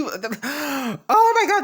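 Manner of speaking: slow, high, drawn-out gasp; gasping oh my God. Real, not acted. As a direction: stretch it in disbelief. Gasping disbelief.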